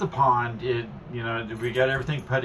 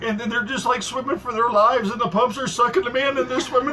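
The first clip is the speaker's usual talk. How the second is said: much higher, building drama; husband dramatizing the goldfish deaths, higher and excited; excited storytelling; 8 semitones higher than usual. Acted. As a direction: tell it like a thriller, pitch up. Dramatic fish story telling.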